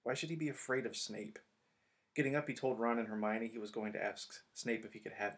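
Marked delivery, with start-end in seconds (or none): none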